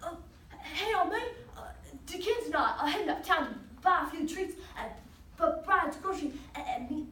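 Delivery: halting speech full of uhs, stuck on me; stuttering. Acted. Boy talking to old man about the store, stuttering. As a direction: trip and stall on the words. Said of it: "had a problem talking".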